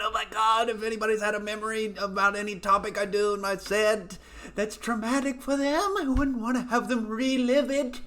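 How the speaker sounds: high, mocking whiny comic voice; mocking sensitive comic. Mocking oversensitive comedians in a high, whiny voice. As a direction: ham it up as the fragile comic who fears triggering anyone.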